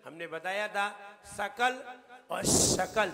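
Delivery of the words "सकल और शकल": plain s in सकल, long hissed sh in शकल; contrasted sibilants. Shown as a demonstration. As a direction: set a light सकल against a strongly whistled शकल. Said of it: "दोनों में कितना अंतर है".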